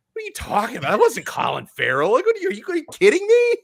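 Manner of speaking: loud, high-pitched incredulous reply; retold outburst of disbelief; mock disbelief. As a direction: act out being floored, voice leaping up. Retelling shocked reply to wife.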